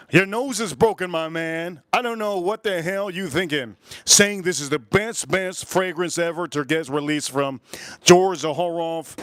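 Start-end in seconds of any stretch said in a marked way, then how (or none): none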